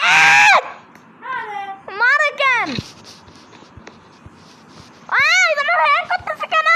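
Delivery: high, loud complaint; angry shout. Real, not acted. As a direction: yell in frustration. Angry yelling during game. (রাগী চিৎকার)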